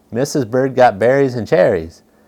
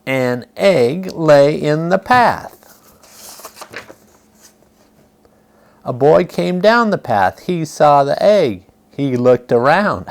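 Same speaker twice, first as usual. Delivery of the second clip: put-on reading to children; 3 semitones higher than usual. A narrator's reading. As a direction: read it out gently to little listeners. Reading picture book aloud.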